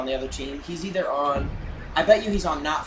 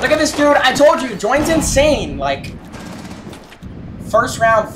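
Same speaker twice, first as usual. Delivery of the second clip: excited shout; 7 semitones higher, 3 dB louder than usual. Real, not acted. Hyped at enemy's play.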